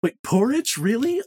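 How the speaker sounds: wait, what, really?; surprised. Real, not acted. Surprised at odd answer.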